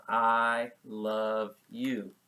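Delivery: drawn-out "I love you" while signing it; very slow "I love you". Shown as a demonstration. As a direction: stretch "I love you" out slowly with the hands. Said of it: "This is how people do".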